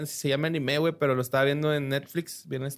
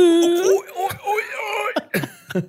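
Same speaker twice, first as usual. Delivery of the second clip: fighter grunts; slower than usual. Retold or imitated. Imitating game fighter cries.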